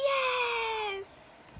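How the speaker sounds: high happy "Yes!"; joyful yes. Acted. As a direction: cry it out gladly. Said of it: "it's shouted".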